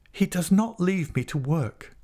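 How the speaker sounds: high, fervent defence; spring to his defence, voice high; fervent cry. A narrator's reading. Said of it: "she cried".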